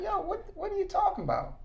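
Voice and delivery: retelling his own disbelief, voice jumping up; mock stunned protest; shocked disbelief.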